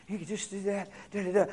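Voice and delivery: very soft sly coaxing; enemy's whisper. Acted. Devil coaxing the listener toward sin, hushed. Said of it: "him says".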